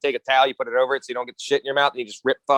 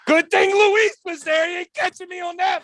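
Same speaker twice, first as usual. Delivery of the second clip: mock shout. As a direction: belt it out as a joke. Joking shout about friend Luis.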